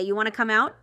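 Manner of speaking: ordinary talk, nothing marked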